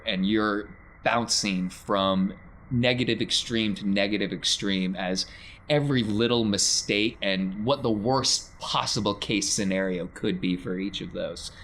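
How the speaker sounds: ordinary talk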